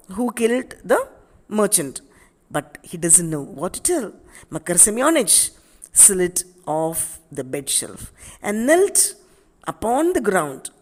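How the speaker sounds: normal talking voice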